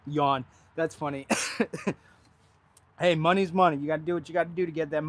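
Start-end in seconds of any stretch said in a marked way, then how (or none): 1.3-1.9 coughing up water. Real, not acted. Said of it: "that water went down the wrong pipe".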